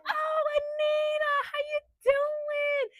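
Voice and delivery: mocking a too-sweet hello, high and slow; fake sweet greeting. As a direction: ham up a fake-friendly hello, drawn out. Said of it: "She's like".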